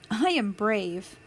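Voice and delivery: ordinary delivery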